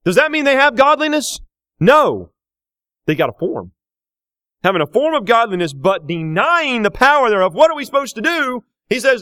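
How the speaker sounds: loud, rising preacher voice; full-force preaching. Acted. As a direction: drive each phrase home from the pulpit.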